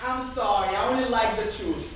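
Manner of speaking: usual speaking voice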